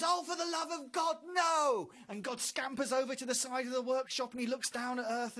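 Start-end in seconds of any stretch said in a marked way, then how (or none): 0.0-1.9 high, loud anguished yell; anguished shout. Retold or imitated. Person on earth shouting at God in despair. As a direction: cry out in despair. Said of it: "someone down there goes".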